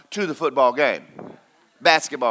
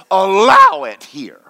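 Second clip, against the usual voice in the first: loud preaching shout; 8 dB louder, slower than usual. Real, not acted.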